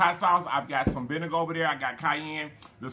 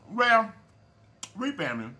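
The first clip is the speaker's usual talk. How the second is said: talking through a mouthful; mouth full; 5 semitones higher than usual. Real, not acted. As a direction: speak round a bite of food. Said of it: "I can talk with my mouth full".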